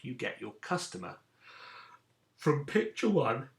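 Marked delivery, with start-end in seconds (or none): 2.4-3.5 words stretched by a yawn. Real, not acted. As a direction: talk through a yawn. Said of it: "I'm yawning".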